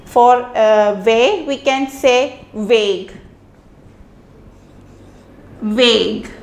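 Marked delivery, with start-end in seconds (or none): 2.5-6.3 Weg with a v sound, veg; German Weg. Shown as a demonstration. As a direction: start Weg like a V. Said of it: "It is pronounced as Weg".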